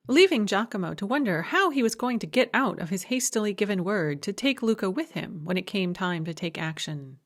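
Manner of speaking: ordinary delivery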